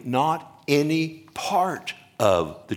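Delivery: "not any part" spaced out, each word weighted; emphatic stress. Shown as a demonstration. Preacher explains the emphatic Greek word, stressing "not any part". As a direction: lean hard on "not any part" with deliberate pauses. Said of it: "okay, it's emphatic".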